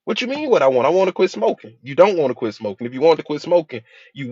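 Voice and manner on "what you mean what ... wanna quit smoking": defensive client. Retold or imitated. Client answering the counselor.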